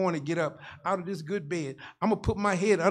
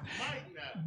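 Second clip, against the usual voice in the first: "Might not" whispered, voiceless and much softer; 14 dB quieter than usual. Shown as a demonstration. Preacher stresses the key word to the congregation, whispered. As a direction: drop to a hush for "Might not". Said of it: "Underline that word".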